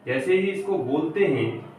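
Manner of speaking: normal talking voice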